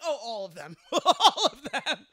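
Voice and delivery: amused horror dissolving into laughs; laughing realisation. Retold or imitated. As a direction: repeat it through laughter, drawing out the second take.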